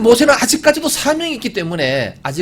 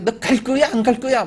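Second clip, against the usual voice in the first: a hint of an irritated parent, higher; mild mock scolding; 8 semitones higher than usual. Parent scolding a child glued to a game, mildly irritated. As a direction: nag the child half-heartedly. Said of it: "부모가 성질 냅니다".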